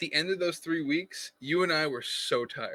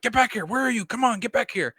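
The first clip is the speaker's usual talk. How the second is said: mock urgent call, pitched higher; impatient boss; 10 semitones higher, 3 dB louder than usual. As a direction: nag him to get back, higher up. Quoting JD's impatient texts.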